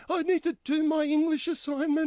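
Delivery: a touch of a whiny schoolgirl; mock whiny girl. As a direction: play the annoyed neighbour girl. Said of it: "There was a girl going".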